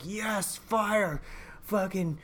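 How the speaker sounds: put-on high, eager kid voice listing toys; excited little boy. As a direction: imitate a little boy who's thrilled. Voicing excited little son.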